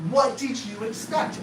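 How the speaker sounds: ordinary delivery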